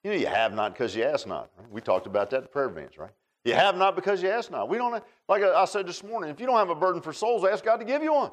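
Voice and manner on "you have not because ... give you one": high, loud preacher's push; full-force preaching. Acted. Pastor urging the congregation, preaching at full force. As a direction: drive the point home to the pews.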